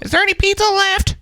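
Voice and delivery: put-on high childish whine; kid voice. Dad imitating his kids asking for pizza, high child voice.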